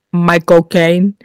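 slow, lightly done Michael Caine accent; a quick mock Michael Caine accent. Shown as a demonstration. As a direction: drawl his name in a Michael Caine accent. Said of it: "I can do two accents".

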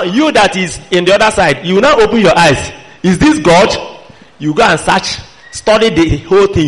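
ordinary talk

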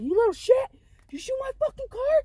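put-on shrieking high furious yell at a kid. Yelling at the kid who shot her car, furious high shout. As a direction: scream it out, outraged. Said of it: "I was mad".

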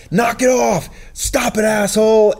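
high, loud shout to stop strangers fighting; yelling to break up a fight. Retold or imitated. Shouting at people fighting in college, loud and high. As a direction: bark it at the fighters to make them quit. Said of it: "I'm like".

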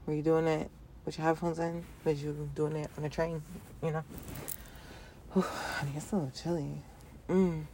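ordinary talk, nothing marked

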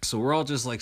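ordinary talk, nothing marked

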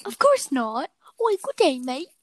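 a cartoon Englishman; hammed-up British accent; mock British accent. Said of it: "British accent".

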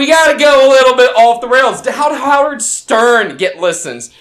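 loud ranting. Real, not acted. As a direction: get loud and off the rails. Jokey defensive rant about going wild.

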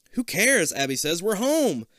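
high, loud happy cheer; gleeful. Acted. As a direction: cheer about being home. Abby cheering to be home.

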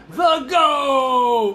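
loud. Shown as a demonstration. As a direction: belt out the word goat. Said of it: "You gotta what 'em?".

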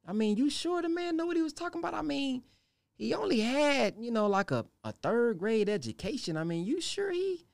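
high, fast sneering doubter; mocking tempter. Devil mocking the teacher's schooling, high and fast. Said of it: "He's casting an evil suggestion".

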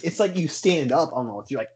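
ordinary talk

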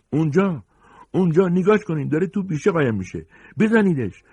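high, full-voiced yell; shouted warning. Pursuer alerting the gang to the fleeing man, loud high shout. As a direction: cry out the alarm and urge them on. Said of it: "هشدار داده شد".

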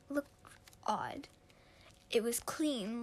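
ordinary delivery, nothing marked

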